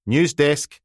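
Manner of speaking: cheery reporter picking up; bright news desk greeting; brisk phone answer. Acted.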